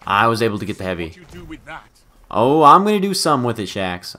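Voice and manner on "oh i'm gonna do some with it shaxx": raised, loud excited vow; eager boast. Real, not acted. Player promising Shaxx action with the heavy, excited. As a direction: burst out with eager intent. Answering announcer, boastful first take.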